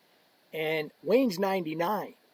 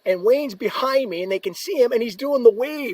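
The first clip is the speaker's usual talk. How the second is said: excited storytelling; 6 semitones higher, 6 dB louder than usual. Real, not acted. Telling the funny climax.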